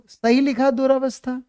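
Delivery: teacher testing the class with दुरावस्था; quizzing question. Shown as a demonstration. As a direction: challenge students to judge. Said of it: "जल्दी बताओ".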